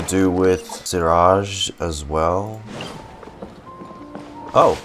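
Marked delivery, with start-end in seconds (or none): none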